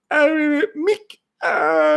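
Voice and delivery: high strained cry of Mick; Rocky yelling for Mick, pitched high; Rocky calling Mick. Retold or imitated. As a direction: call out like Rocky in a high wail. Rocky movie quote impression.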